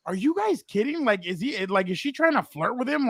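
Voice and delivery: high-pitched, incredulous retort; mock-baffled questioning. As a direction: retell your disbelief, pitch jumping up. Retelling disbelief at strangers in line.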